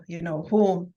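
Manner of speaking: usual speaking voice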